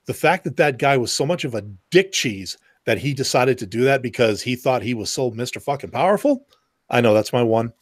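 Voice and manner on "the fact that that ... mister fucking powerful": usual speaking voice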